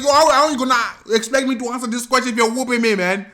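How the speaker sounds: high, loud complaint; acted exasperated protest. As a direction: protest as the beaten child. Child protests during the beating.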